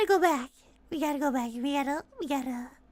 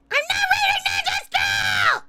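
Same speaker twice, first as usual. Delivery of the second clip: high, strained mock-outraged shout; yelled denial; 7 semitones higher, 3 dB louder than usual. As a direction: explode in playful protest.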